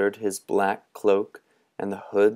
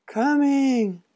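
put-on much higher, long call of a small boy; far-off cry. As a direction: Pipkin answering from afar. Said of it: "was the cry".

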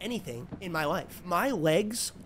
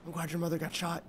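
whispering; 10 dB quieter than usual. Real, not acted. Muttering at deer.

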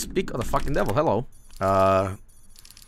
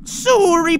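very high, much louder put-on falsetto, slow; bastard voice; 9 dB louder than usual. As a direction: squeak it out as a mock clueless foreigner. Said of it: "The bastard voice".

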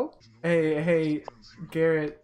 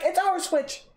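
high-pitched mock sibling argument; imitated whiny comeback; retold indignant protest; 9 semitones higher than usual. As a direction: protest like a squabbling kid, pitched way up. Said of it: "I'm like".

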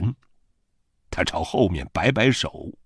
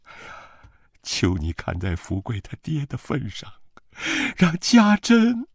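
desperate begging; 12 semitones higher, 7 dB louder than usual. A narrator's reading. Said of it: "我娘的身体弯下去求他".

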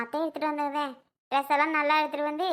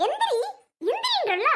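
sharp order; 11 semitones higher than usual. Acted. Anju orders him to get up.

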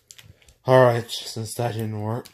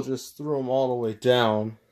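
normal talking voice

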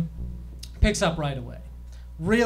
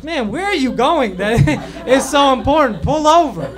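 laughing plea; 5 semitones higher, 4 dB louder than usual. Retold or imitated. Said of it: "I was like".

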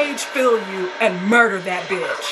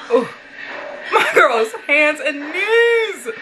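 higher, louder, drawn out; slow shout; 7 semitones higher, 4 dB louder, much slower than usual. Real, not acted. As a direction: stretch out the cry in alarm. Character crawling on screen.

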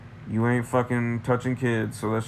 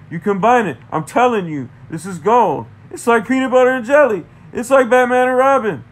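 louder and higher, building hype; excited rant; 11 semitones higher, 8 dB louder than usual. Real, not acted. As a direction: sell it like gold. Excited pitch comparison.

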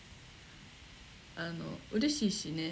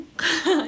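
burst of laughter; 8 semitones higher, 12 dB louder than usual. Real, not acted. Laughing at own answer.

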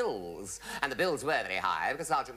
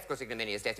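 normal talking voice

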